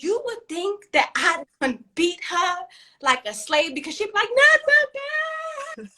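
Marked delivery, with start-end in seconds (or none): 4.3-5.9 very high, drawn-out mock wail; terrified child's scream. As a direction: shriek in hammed-up terror like a kid. Imitates daughter screaming at belt threat.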